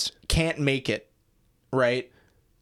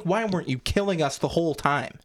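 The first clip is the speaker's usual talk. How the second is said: ordinary talk, nothing marked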